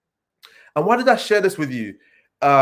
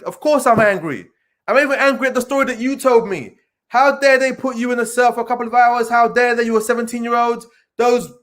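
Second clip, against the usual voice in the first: put-on higher, indignant voice of a young listener; imagined listener objecting; 6 semitones higher than usual. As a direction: raise the pitch, protesting as the listener. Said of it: "but you might even say".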